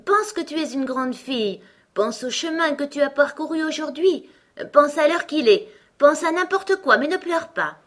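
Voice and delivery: high-pitched insistent plea, building in volume; urgent pleading. Acted. Queen distracting Alice from crying, urgent pleading.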